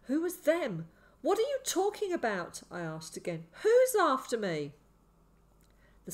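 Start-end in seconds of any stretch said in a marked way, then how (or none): 0.0-2.6 high, loud, bewildered questions; fearful confusion. A narrator's reading. Boy questions sick man, confused and afraid. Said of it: "My confusion and fear deepened". 3.6-4.7 high, loud question; alarmed demand. A narrator's reading. Boy asks who hunts him, alarmed.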